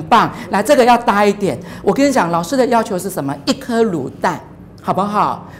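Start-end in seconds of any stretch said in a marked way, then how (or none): none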